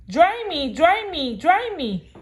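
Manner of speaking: high, insistent chick cry; demanding baby chick. Acted. Soggy chick demanding that Jack dry it, high and insistent. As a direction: whine it over and over. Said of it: "cried the soggy back chick".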